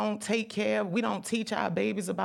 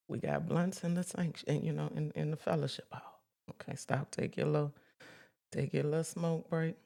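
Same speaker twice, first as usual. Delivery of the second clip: hushed joke; 8 dB quieter than usual. Real, not acted. Joking hushed aside to church.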